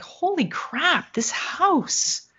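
slow, high "holy crap" of dawning dread; mock-awed gasp of disbelief, slowed down; awed shock. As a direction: let the realization land, drawn out and high. Retelling her shocked reaction.

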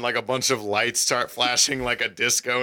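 normal talking voice

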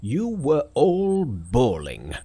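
slow, loud angry rebuke; slow furious old master; angrily. A narrator's reading. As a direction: scold them for the racket. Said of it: "said the patriarch angrily".

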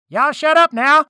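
high, loud yell over a crowd; angry shout. Acted. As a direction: explode to silence everyone. Unheard king silences noisy crowd.

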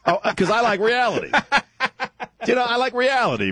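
high, laughing through words. Real, not acted. Laughing while explaining.

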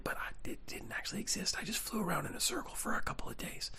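flew-to-Australia part plain, then drop to a whisper; pilot's hushed mock confession; mock secret confession. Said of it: "You've never heard a pilot go".

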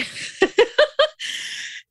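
high, airy giggle; real laugh at her own story; helpless high-pitched giggling. Real, not acted. Laughs at her own retold outburst.

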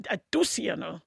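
ordinary talk, nothing marked

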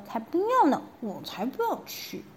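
put-on high, sulky little girl voice; sulky princess. Princess sulking at the doctor. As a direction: pout it like a stubborn child. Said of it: "小公主说".

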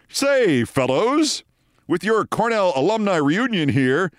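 high, lilting suited-gent voice; breezy old-school hail to the fellows; Scott Carter. Retold or imitated. Producer breaking up an office quarrel, jovial gent voice. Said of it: "So Scott comes over and says".

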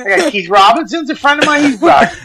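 high, panicky backpedalling; rage turned to fear. Retold or imitated. Defending himself to the homeless guy, high comic panic. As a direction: plead frantically in a hammed-up squeal. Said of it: "rage went to fear".

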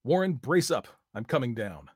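loud determined call; resolute shout. A narrator's reading. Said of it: "shouted in a resolution".

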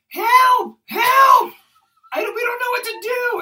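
mock-panicked screams, very high and loud; panicked cry. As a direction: shriek for help, imitating her panic. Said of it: "She opens the door in a panic".

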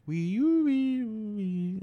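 high wailing vocal sci-fi siren; sci-fi noise. Pretend. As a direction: imitate a spaceship warble by mouth. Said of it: "supposed to be like a sci-fi noise".

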